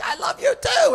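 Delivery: very high, loud shout back across the airport. Retold or imitated. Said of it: "and I'm like".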